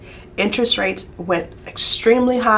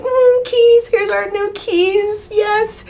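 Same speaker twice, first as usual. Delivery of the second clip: giddy pretend excitement; 12 semitones higher, much slower than usual. Pretending to receive new house keys, giddy and high. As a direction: squeal with delight over the new keys. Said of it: "Or I wanted to be like".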